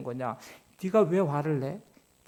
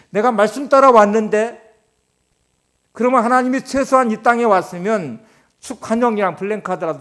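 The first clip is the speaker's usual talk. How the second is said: loud, driving sermon delivery; full-force preaching; 4 semitones higher, 9 dB louder than usual. Acted. Pastor pressing the congregation about the famine, loud and urgent. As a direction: preach it hard to the back row.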